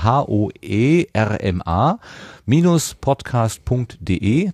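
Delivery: slow, letter-by-letter dictation; careful spelling out. Real, not acted. Host repeating a website for listeners, slow careful spelling. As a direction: spell it out slowly so nothing gets garbled. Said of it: "Nicht, dass wir die U R Ls hier wieder verschludern".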